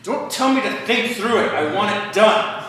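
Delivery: hammed-up loud, high, insistent spouse; mock angry wife. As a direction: play the wife snapping back. Retold quarrel, wife voiced.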